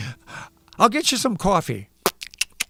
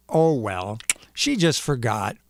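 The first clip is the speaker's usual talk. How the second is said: normal talking voice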